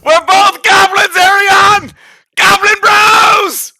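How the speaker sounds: high, loud, slow goblin yells; high-pitched goblin shouting; excited goblin voice. Acted. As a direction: cheer it like a giddy goblin. Goblin character cheering at friend.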